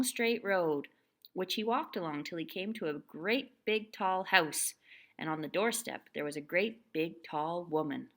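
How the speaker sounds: ordinary delivery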